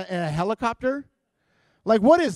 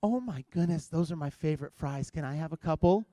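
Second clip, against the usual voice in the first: sweet wife voice; 3 semitones lower, 9 dB quieter than usual. Retold or imitated. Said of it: "she's like".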